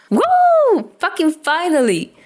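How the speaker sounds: loud, high whoop of triumph; excited cheer. Acted. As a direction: cheer it out, finally relieved. Dazai cheers after adoption.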